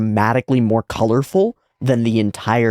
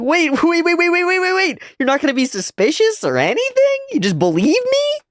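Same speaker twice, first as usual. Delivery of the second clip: much higher, squeaky and giggling through the waits; squeaky panicked guy. Retold or imitated. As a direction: flail in a squeaky, stunned voice. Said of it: "And he's like".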